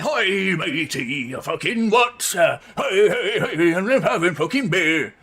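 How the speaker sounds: loud hammed-up pirate yell with laughter; mock pirate. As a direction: bellow it like a drunk pirate at a pub. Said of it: "They do sound a bit like".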